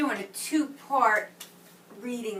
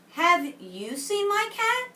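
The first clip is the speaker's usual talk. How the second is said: put-on boy asking; 10 semitones higher, 4 dB louder, slower than usual. Boy asks about lost cat.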